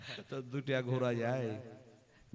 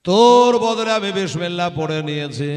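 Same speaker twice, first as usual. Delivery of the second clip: louder, rising preached punchline; triumphant climax; 3 semitones higher, 15 dB louder than usual. Acted. Martyr answering the whipper, triumphant. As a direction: ring it out as the answer.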